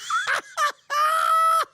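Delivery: loud, very high burst of helpless laughter; high cackling laughter. Real, not acted. Host cracking up at a scammer's plea, high cackle. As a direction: lose it completely in a long cackle.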